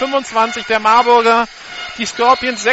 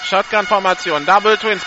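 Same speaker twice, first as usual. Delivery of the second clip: ordinary delivery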